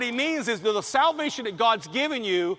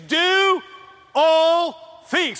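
higher and louder, declaiming; loud proclaimed quote; 6 semitones higher, 5 dB louder, much slower than usual. Retold or imitated. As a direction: proclaim it to the room. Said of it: "he says".

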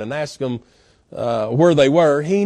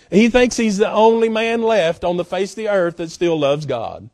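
ordinary delivery

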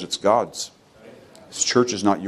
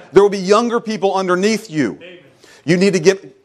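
high, driving preacher's exhortation; preaching at full force; 10 semitones higher, 3 dB louder than usual. Acted. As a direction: press the point on the congregation. Preacher pressing the congregation.

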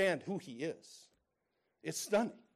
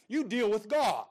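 stern rebuke; 7 semitones higher, 9 dB louder than usual. Retold or imitated. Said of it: "He said, the Bible says".

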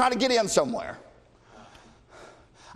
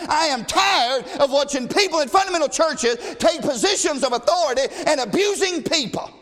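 preaching at full force; 5 semitones higher than usual. Acted. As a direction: thunder it from the pulpit.

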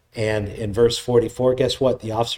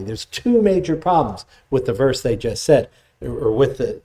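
normal talking voice

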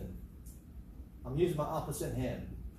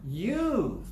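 put-on proud child; 7 semitones higher, 4 dB louder than usual. Boy answering grandma.

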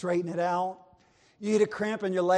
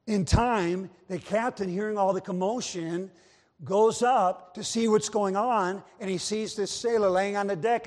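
ordinary delivery

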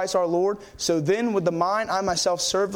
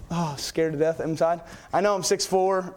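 ordinary talk

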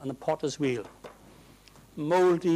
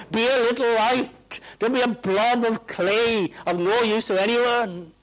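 raised, loud, urgent preaching; full-force exhortation; 8 semitones higher, 6 dB louder than usual. Acted. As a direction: urge the congregation on with fire.

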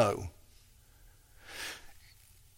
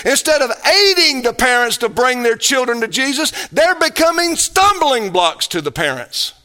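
preaching at full force; 7 semitones higher, 4 dB louder than usual. Acted. Pastor to congregation, fervent preaching, high and louder. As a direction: pitch climbing high, voice swelling louder.

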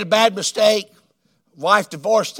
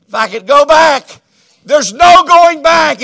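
louder, higher, rising to a cry; pleading regret; 5 semitones higher, 9 dB louder than usual. Retold or imitated. Preacher voicing the lady's letter, building to a loud cry. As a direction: plead it as if it's too late. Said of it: "that sweet lady that just said".

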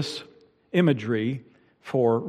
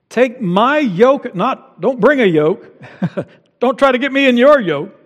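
emphatic preaching; 9 semitones higher, 9 dB louder than usual. Acted. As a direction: proclaim it to the congregation. Pastor quoting Jesus, emphatic.

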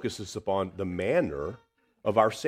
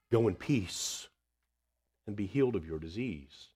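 tender reassurance; 7 dB quieter than usual. Retold or imitated. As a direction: soothe a trembling woman. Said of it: "that is not the tone".

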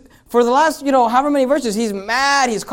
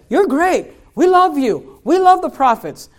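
fake flattery; 6 semitones higher, slower than usual. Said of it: "Someone who says".

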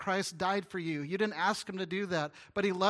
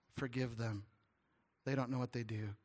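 soft plea; 5 semitones lower, 7 dB quieter than usual. Retold or imitated. Jesus on the cross.

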